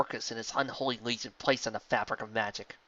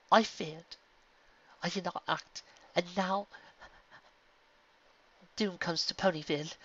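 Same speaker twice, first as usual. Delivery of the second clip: devastated; 6 semitones higher, 4 dB quieter than usual. Acted. Luna devastated after failure.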